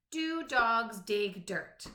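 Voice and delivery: "Do dogs dig dirt?" said slowly, each D at the start of a word hit; slow alliterative read. Shown as a demonstration. Teacher to young pupils, slow with each opening D stressed. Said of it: "all the words in the sentence have the same beginning sound".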